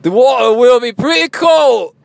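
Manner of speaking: shouted, high mock Scottish accent. Host reading listener's email aloud, shouted Scottish accent. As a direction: belt it out in a hammed-up Scots brogue. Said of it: "Should I read it with my Scottish accent?".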